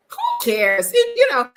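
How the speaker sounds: very high, loud exasperated squeal. Real, not acted. Fed up with being told where to shop, high exasperated squeal. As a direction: shriek it out, fed up.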